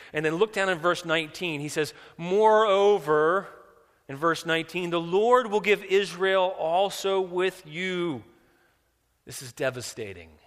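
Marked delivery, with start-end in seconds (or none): 2.2-8.2 loud, high, drawn-out scripture reading; full-force preaching. Real, not acted. Preacher reading out Saul's sentence from verse nineteen, full-force preaching. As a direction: proclaim the verse slowly and loudly.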